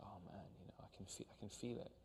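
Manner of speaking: near-whispered, very soft inner reaction; hushed awe. Retold or imitated. Actor reliving the sound of the leaves, hushed awe. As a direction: murmur it under the breath, as if moved. Acting out being moved by sound.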